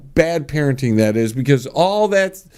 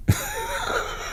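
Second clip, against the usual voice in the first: high-pitched laugh; 10 dB quieter than usual. Real, not acted. Laugh after quote.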